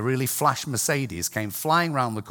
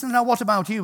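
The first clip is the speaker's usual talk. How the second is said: priest asking sweetly, pitched up; a pointed, raised question; sly priest; 11 semitones higher, 5 dB louder than usual. Retold or imitated. Drunk priest to young man, sly and raised.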